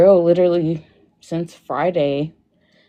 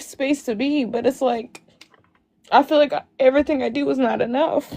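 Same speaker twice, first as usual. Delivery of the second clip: higher, tear-choked voice; crying; 4 semitones higher than usual. Real, not acted. Telling the host he never forgives her, crying. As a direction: keeps talking while she cries. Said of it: "come on your live crying".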